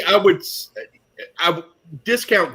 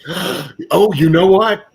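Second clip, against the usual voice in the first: gasp, then a louder, higher "you know what?"; sudden realisation; 4 semitones higher, 4 dB louder than usual. Real, not acted. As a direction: struck by a bright idea mid-sentence. Sudden realisation about the squib.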